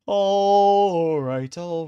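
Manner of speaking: sudden loud all right; loud excited shout. Real, not acted. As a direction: shout it out, pumped.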